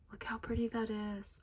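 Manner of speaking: hushed, delighted whisper. Real, not acted. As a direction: breathe it in awe. Whispered while pulling out trim.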